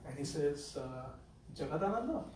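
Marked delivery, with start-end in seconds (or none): 1.5-2.2 softer gentle call; very softly. Retold or imitated. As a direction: call his name softly through the door. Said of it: "Very softly".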